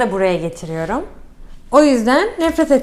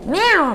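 imitated meow; 5 semitones higher than usual. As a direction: meow at the cat, rising then falling. (miyav taklidi)

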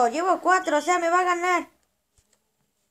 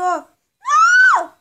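a sudden, much higher, much louder shriek of no; shout; 14 dB louder than usual. Real, not acted. Gamer shrieking no at the screen, loud shout. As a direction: scream the refusal at the top of your voice. Game player refusing offered friendship.

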